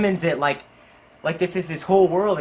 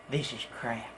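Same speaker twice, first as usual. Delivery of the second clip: low, very quiet imitated verdict; hushed, understated customer complaint; deadpan disgust; 5 semitones lower, 10 dB quieter than usual. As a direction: drop it softly and low in displeasure.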